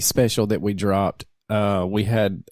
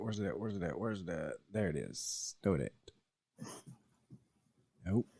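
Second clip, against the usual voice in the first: muttering to himself; 4 semitones lower, 13 dB quieter than usual. Real, not acted. Host fighting his computer, muttering.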